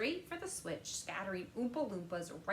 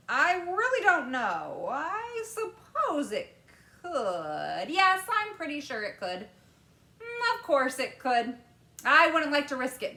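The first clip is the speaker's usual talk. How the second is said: louder, higher Wonka, slowing as he wonders; musing candymaker; 8 semitones higher, 12 dB louder than usual. A narrator's reading. Wonka pondering whether people can be sent, louder and slowed. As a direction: think it through aloud, hesitating.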